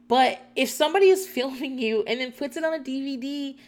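ordinary delivery

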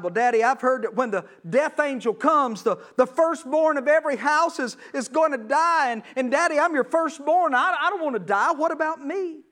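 put-on pleading little boy's voice; frightened little boy. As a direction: beg your father, scared you'll die tonight. Pharaoh's son fears the death angel.

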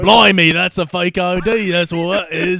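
a lightly voiced bad British Q; playing a shitty Q with a mock British twang; mock British accent. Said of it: "He's like".

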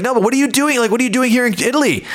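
puzzled tourist. Retold or imitated. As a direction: ask it like a curious fellow Canadian. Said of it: "he's like".

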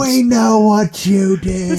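high, loud, long-drawn holler; mock mom bellowing after a thief; imitated yell. Storyteller as mom yelling out the window, drawn-out. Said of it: "she yells out the window".

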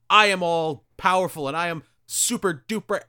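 hammed-up bragging; mock boasting. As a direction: puff yourself up like a cartoon villain. Acting out Osborn boasting to father.

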